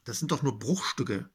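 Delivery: ordinary talk, nothing marked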